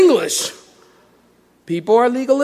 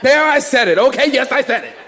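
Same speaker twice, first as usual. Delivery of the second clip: punchy, raised-pitch confession to the room; defiant admission; 2 semitones higher than usual. Real, not acted. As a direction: own up to it boldly for a laugh. Repeats the muted admission loudly.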